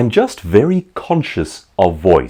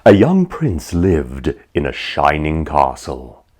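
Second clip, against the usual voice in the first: slow, lowered fairy-tale telling; storybook narration; 4 semitones lower, slower than usual. Acted. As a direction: read it like a bedtime story.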